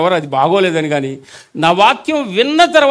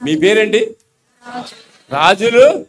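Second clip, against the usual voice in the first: ordinary talk, nothing marked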